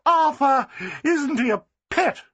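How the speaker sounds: high, gushing society lady; Flossie, delighted. A narrator's reading. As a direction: crow with triumph, slowing at the end.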